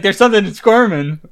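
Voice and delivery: loud, alarmed retold cry; panicked cry. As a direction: blurt it out in a panic. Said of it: "He's like".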